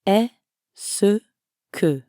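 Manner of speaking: "Est-ce" then a pause then "que"; syllable by syllable. Shown as a demonstration. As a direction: break it into two slow parts. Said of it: "Notice the difference".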